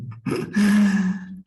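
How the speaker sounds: high amused laugh; a short light laugh; laughing at the easy answer. Real, not acted. Amused at own point.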